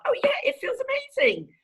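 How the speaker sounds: high-pitched sarcastic gush; mock enthusiasm. Mocks therapist's question about feelings.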